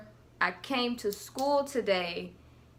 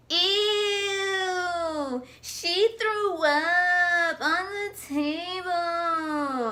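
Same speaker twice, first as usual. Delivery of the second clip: higher, slowed, sing-song mock "Ew"; mocking classmates; 7 semitones higher, much slower than usual. Classmates jeering at the girl who puked, sing-song taunt. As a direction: tease her in a singsong.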